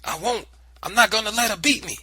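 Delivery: high, loud and fast; defiant yell. Acted. Boy refusing the family, defiant loud yell.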